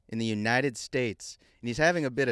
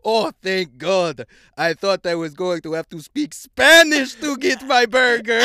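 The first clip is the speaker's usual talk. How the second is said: relieved Russian man; 6 semitones higher, 11 dB louder than usual. Acted. Russian customer relieved to get his burger, loud accented relief. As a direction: exclaim relief loudly as the Russian. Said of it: "looks relieved and says".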